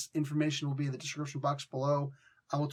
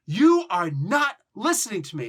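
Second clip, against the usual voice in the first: loud, each word hit separately; forceful, emphatic scolding yell; yelling at somebody; 4 semitones higher, 8 dB louder than usual. Shown as a demonstration. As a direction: accuse them, stressing every uncontracted word. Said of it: "when you're yelling at somebody".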